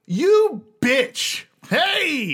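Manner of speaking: high, loud mock-abusive hello to a buddy; frat-boy whoop of welcome; rowdy greeting. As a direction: holler it high, drawing out the hey. Ace Buzz greets a returning friend.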